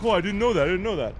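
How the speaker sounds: mock sheepish fan. In-laws mocked to the stream as sheepish fans. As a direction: play the fan caught out, a bit hammed-up. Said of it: "they're like".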